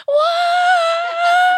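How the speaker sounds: high, long drawn-out "whoa"; imitated crowd cheer. Singer imitating the crowd's reaction to tears, mock cheer. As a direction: mimic a roaring crowd, loud and high. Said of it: "They were like".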